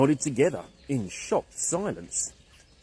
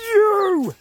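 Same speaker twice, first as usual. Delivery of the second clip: sharp, much higher loud shout; 9 dB louder than usual. Acted. Angry caveman shouting at the apple thief. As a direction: blast it at the bird. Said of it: "he started to shout".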